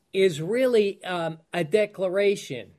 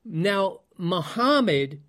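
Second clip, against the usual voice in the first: ordinary delivery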